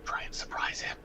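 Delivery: soft unvoiced whisper. Real, not acted. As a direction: whisper so the enemy can't hear. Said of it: "when I'm talking like that".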